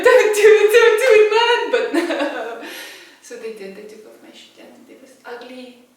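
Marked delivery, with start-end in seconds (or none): 0.0-1.6 high, loud frantic plea; man begging paramedics not to remove his shoe; pleading shout. Retold or imitated. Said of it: "that's why I'm like". 3.8-5.8 much softer, grossed-out murmur; hushed disgust. Real, not acted. Disgusted quiet description.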